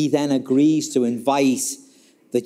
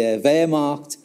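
usual speaking voice

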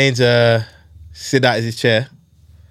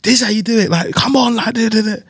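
high mock coach voice, showing off; coach demonstrating; 7 semitones higher than usual. Coach to players in the rain, high mock instructing voice. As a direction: play the coach hyping up the drill. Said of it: "he's like".

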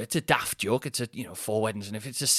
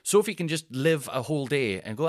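ordinary talk

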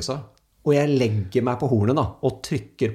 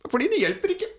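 put-on high little-boy voice; a son's voice, pitched high and simple; child's voice. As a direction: answer like a small matter-of-fact kid.

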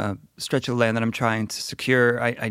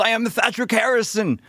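higher, grand knight declaration; knightly persona; 11 semitones higher than usual. Acted. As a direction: proclaim it as the knight. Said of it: "when I hold my helmet and my shield".

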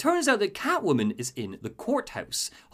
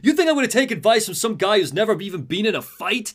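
scornful reporter; 6 semitones higher, 4 dB louder than usual. Retold or imitated. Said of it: "Jack mules".

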